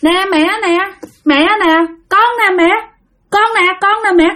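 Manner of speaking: high little voice insisting to mother; child spirit. Acted. Spirit child identifies itself to mother, high pleading voice. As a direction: plead for recognition as a small ghost.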